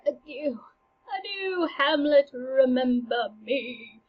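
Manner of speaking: drawn-out, mournful ghostly farewell; departing ghost. Acted. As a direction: let the parting plea stretch out like a ghost. Departing ghost's last words, acted.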